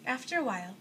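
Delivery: usual speaking voice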